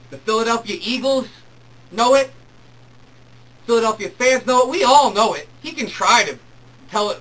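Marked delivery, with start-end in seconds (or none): 0.1-5.5 higher and louder, rising; emphatic, climbing chant-like insistence; building insistence. Real, not acted. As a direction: press the point harder each time. Building insistence about McNabb.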